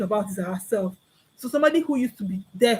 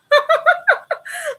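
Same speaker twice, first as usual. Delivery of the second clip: high squealing laugh at the joke; burst of laughter; 5 dB louder than usual. Real, not acted. Laughing at her friend's line, a loud high laugh.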